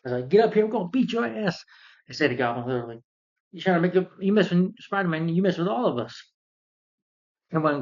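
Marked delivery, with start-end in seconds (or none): 0.3-1.6 high-pitched mock New Yorker threat; imitated angry heckler. New Yorkers threatening Goblin, high mock-angry heckling. 3.5-6.3 higher mock-tough heckling; imitated crowd defiance. New Yorkers standing up for Spider-Man, high mock defiance.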